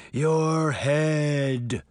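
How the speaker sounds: long, ghostly groan; groaning phantom. A narrator's reading. Sabrina as the Horseman demanding from Crane, groaning. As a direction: groan each word out menacingly. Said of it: "Sabrina groaned".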